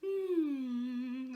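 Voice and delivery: gentle sigh. Shown as a demonstration. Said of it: "a really gentle sigh".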